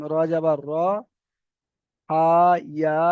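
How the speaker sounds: ordinary talk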